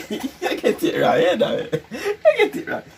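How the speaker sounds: laughing through words. Real, not acted. Laughing at the guess.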